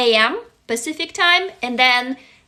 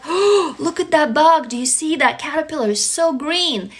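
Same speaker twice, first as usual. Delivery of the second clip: higher, bright wonder at the bug; excited parent; 3 semitones higher than usual. Retold or imitated. Parent showing an imagined child a caterpillar, full of wonder. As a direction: marvel like a parent with a toddler. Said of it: "or I'm like".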